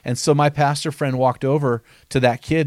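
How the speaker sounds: usual speaking voice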